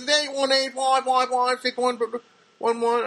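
high, loud, droning digit recital; mimicked lady customer. Lady giving her number to the cashier, high mimicked voice. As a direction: rattle off the number in a mock high voice.

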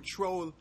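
dropped th on "Tro"; neighborhood "tro". Shown as a demonstration. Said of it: "you say tro".

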